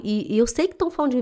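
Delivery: usual speaking voice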